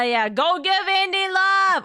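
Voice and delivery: high, loud, drawn-out cheer; hyped shout-out. Real, not acted. Streamer urging viewers to support a co-host, hyped. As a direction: call out slowly to the whole crowd, hyping a friend.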